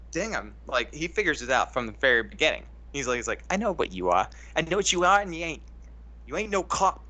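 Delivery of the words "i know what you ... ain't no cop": higher-pitched mock Boston accent; bad Boston accent. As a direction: attempt a Boston cop-talk accent, badly. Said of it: "I can't do the Boston accent".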